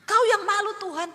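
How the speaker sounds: high, loud challenging cry; mock threat to God. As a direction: throw the words up at God defiantly. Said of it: "tanpa sengaja ngomong begitu".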